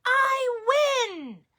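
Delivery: high, loud triumphant cry; excited cry. Acted. Baba Brena winning the dreidel game, excited shout. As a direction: shout it like a delighted winner.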